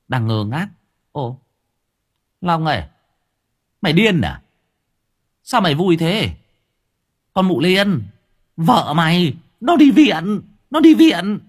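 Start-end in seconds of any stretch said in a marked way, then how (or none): 1.1-11.4 loud, high, rising shout; high loud neighbour bellowing news. A narrator's reading. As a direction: yell the bad news at him.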